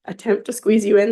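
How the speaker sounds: ordinary talk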